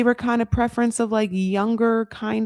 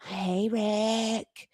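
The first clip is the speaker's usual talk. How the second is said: tempting Colombia; 4 semitones higher, slower than usual. Pretend. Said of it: "Columbia was just like".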